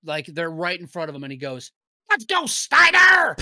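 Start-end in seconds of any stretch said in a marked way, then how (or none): 2.1-3.4 high, full-voiced crowd yell; imitated fan's shout. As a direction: holler it like a lone fan across the arena. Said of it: "you hear one fan".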